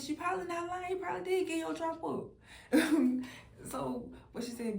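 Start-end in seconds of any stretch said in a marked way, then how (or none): 0.0-2.3 higher, laughing hint of the lady; lightly voiced old lady, laughing; amused retelling. 2.3-3.6 loud, high burst of laughter; real laugh at the joke. Real, not acted. Laughing at the line.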